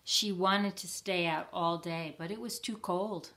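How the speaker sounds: ordinary delivery, nothing marked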